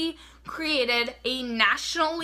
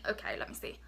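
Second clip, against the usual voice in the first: normal talking voice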